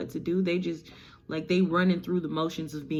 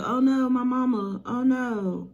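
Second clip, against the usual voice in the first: mock distress, higher; 8 semitones higher, 4 dB louder than usual. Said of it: "He's like".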